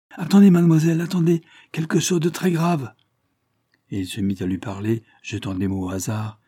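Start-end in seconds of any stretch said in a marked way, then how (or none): 0.1-2.9 bien plus aigu et plus fort, urgent; pressant. A narrator's reading. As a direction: crie pour la retenir en ligne. Retient Janine au téléphone. (Paul Bard retient Janine au téléphone, aigu et fort)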